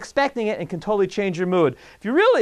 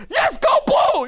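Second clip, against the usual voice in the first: fan cheer; slower than usual. Retold or imitated. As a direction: cheer like a pumped-up football fan. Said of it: "you're like".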